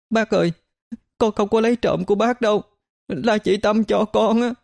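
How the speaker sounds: put-on high, pleading child's voice; crying plea. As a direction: beg through tears, small and high. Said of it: "khóc xin". (giọng trẻ con khóc lóc van xin)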